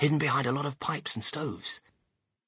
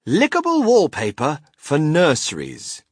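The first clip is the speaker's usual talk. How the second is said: loud, raised, measured announcement; reading out a sign; 4 semitones higher, 8 dB louder than usual. A narrator's reading. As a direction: proclaim the label grandly.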